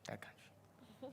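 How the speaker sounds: a sly, breathy stage whisper; playful whisper. Acted. Dodging the question about the dean, whispered. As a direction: whisper it as if hiding the answer.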